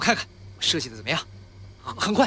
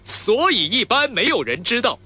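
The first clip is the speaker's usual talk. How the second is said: higher, louder and slow, ringing in the head; head resonance; 7 semitones higher, 6 dB louder than usual. Shown as a demonstration. Actor demonstrating head resonance to his friend. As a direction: ring the line up in your head. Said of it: "这个是头腔共鸣".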